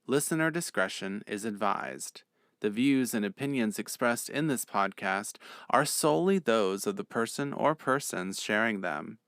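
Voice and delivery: brisk formal disclaimer voice; steady announcer read. Acted. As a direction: read it like a legal notice.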